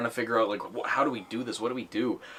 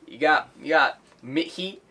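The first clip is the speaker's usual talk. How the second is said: put-on higher kid voice, "Mickey" with a dropped K like "Mihi"; kid mispronouncing; 5 semitones higher, 4 dB louder than usual. Kid prank caller asks the Disney store, K dropped. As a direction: ask for "Mickey" as a kid who can't say K. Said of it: "but he goes".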